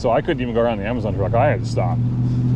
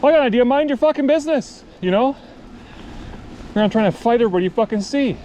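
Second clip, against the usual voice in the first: much higher, indignant reprimand; scolding; 10 semitones higher than usual. Retold or imitated. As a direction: tell him off to mind his business, pitched up. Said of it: "like, the truck driver guy".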